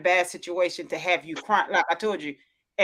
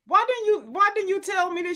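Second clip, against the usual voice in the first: high indignant question; 11 semitones higher than usual. Retold or imitated. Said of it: "And I was like".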